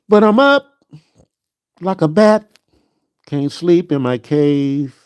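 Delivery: a touch of silly bat talk; silly high voice. Pretend. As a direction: clown about being wide awake. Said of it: "I'm acting dumb".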